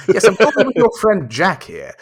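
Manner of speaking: lightly mocked TV host, projecting; Chris Tarrant. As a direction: imitate a game-show host on the line. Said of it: "Chris Tarrant here".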